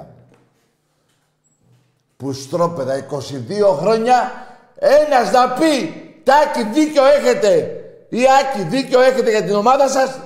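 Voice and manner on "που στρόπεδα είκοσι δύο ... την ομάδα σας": building to a high, sustained scream; outraged, full-force shouting; furious rant. Real, not acted. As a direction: yell the grievance at the top of your voice. Rants at callers who never agree.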